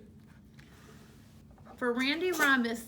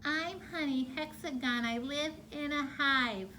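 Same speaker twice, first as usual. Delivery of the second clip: mild bumblebee; 4 semitones higher, 3 dB quieter, slower than usual. Shown as a demonstration. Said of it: "Copy me".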